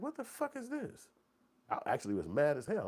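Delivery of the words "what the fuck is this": shocked outburst. Retold or imitated. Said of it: "And I'm like".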